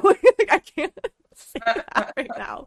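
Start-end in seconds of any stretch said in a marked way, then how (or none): none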